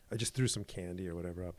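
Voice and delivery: ordinary talk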